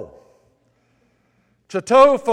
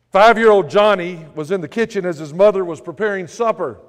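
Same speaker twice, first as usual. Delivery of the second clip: usual speaking voice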